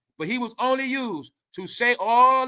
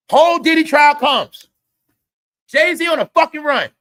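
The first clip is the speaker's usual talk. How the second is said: loud, high-pitched exclamation; real shout mid-rant; shouted outburst; 8 semitones higher, 10 dB louder than usual. Real, not acted. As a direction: burst out shouting, much higher and louder.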